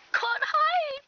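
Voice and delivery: high, loud cry to take cover; urgent alarm. Acted. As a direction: raise the alarm at full voice. Someone is coming, must hide.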